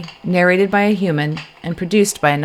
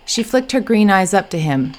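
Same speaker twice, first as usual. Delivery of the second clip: ordinary delivery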